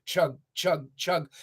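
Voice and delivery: ordinary delivery, nothing marked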